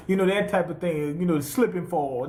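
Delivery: normal talking voice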